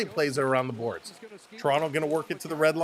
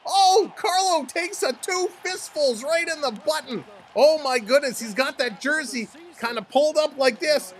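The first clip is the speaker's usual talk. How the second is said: high, loud live hockey call; excited fight play-by-play; 8 semitones higher, 6 dB louder than usual. Real, not acted. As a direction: call the brawl at full excitement, voice raised.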